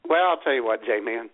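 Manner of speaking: ordinary talk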